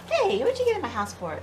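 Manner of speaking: high, loud scold; indignant shout at the spider. Real, not acted. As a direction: tell it off.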